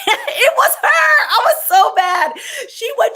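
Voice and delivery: high squeal through laughter; laughing. Real, not acted. Telling viewers about the boss, high laughing outburst.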